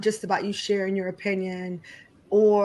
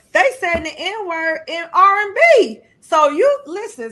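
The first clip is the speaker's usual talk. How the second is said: louder and higher, exasperated; raised voice; 7 semitones higher, 8 dB louder than usual. Real, not acted. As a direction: push it out, fed up. Heated rant about boycotting.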